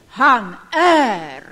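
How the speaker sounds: loud, ringing declaration; preacher's forceful declaration; emphatic proclamation. Real, not acted. Preacher proclaims God's existence.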